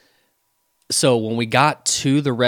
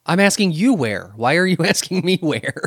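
baffled indignation; 6 semitones higher than usual. Retold or imitated. Acting out baffled customer's reply.

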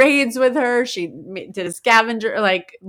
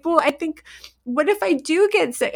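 lightly voiced little girl, high; a touch of fretful kid, higher pitched; worried child; 7 semitones higher than usual. Daughter pleads before school, worried child. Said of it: "she's like".

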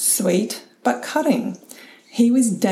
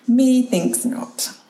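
mock archaic scoff; 4 semitones higher, much slower than usual. Real, not acted. Dry rebuttal of partner's peg theory.

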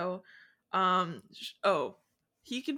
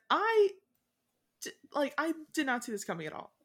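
much higher, louder, fast gasp of surprise; astounded; 7 semitones higher, 3 dB louder than usual. Retold or imitated. Fairfax hearing about the engagement, astounded and high. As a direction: blurt out the shock in a rush. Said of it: "Missus Fairfax is astounded".

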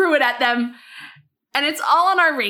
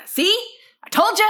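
loud, raised-pitch telling-off; pissy; 9 semitones higher, 4 dB louder than usual. Retold or imitated. Neighbour telling off kids over the ball, pissy. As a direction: scold them with an I-told-you-so edge. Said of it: "But I'm pissy".

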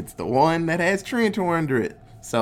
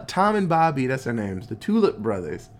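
normal talking voice

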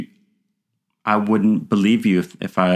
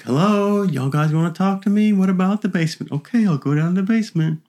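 higher, casual fearless ghost hunter; breezy and unbothered, pitched up; imitating Kent; 10 semitones higher than usual. Kent calling out to the spirits, lightly imitated higher. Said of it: "he's just like".

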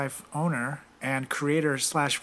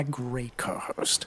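soft whispered aside; whispering; 3 semitones lower, 8 dB quieter, much slower than usual. Acted. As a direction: whisper it as a joke.

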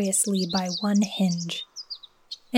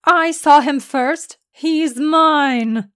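higher, louder possessive yell; 6 semitones higher, 6 dB louder, much slower than usual. A narrator's reading. Man yells in the doctor's face over the leprechaun, loud. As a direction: shout it in her face. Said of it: "Athelard yelled into the woman's face".

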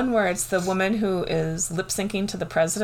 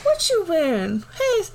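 put-on sassy girl voice; 11 semitones higher than usual. Playing sassy TikTok characters.

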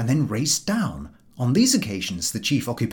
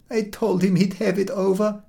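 poor milkwoman; 9 semitones higher than usual. A narrator's reading. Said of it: "says the poor milkwoman".

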